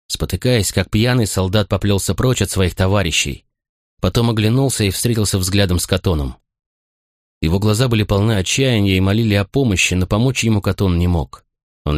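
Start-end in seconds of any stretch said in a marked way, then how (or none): none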